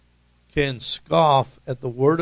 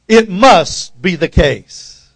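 loud, slow answer It must be the case; modelling the church's loud reply; congregation response; 3 semitones higher, 9 dB louder, slower than usual. Shown as a demonstration. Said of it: "the congregation might reply".

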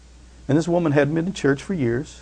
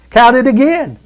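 insistent demand; 10 semitones higher, 9 dB louder than usual. Acted. Widow insists a fourth time.

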